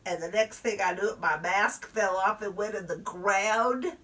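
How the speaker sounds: ordinary talk, nothing marked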